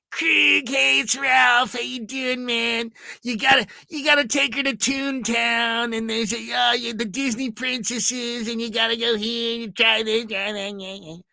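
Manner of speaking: high, eager rambling voicemail; Ralph's voicemail. Retold or imitated. Voicemail from coworker about Disneyland tips, high and eager. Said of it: "It's all Ralph".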